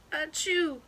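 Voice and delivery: sneeze. Acted. Groundhog sneezes.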